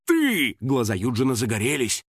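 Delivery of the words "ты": high, pouncing exclamation; excited discovery. A narrator's reading. Hunter spots Финик, triumphant. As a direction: jump high on it.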